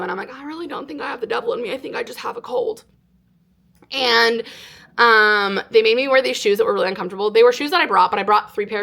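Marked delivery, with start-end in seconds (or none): 0.3-2.8 high, soft little protest; retold timid self-defence; meek denial. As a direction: gently insist in a small voice. Meekly denying having the devil.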